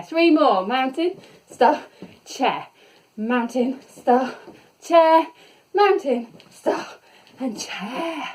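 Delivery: loud, punchy cues in rhythm; coach calling poses. Real, not acted. Fitness teacher calling the moves to her class, energetic. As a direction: drive the class through each pose. Calls poses during exercise.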